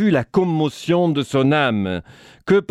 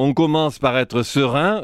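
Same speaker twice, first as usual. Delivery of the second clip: ordinary talk, nothing marked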